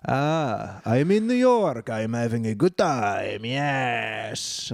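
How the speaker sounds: slow, hammed-up French accent. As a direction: play a carefree French tourist in a French accent. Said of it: "why that was my French accent".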